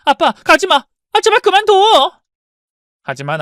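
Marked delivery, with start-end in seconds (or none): 0.0-2.2 loud, much higher, slow pleading cry; desperate plea. Acted. Daughter crying after her departing father, loud desperate plea. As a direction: beg him not to go.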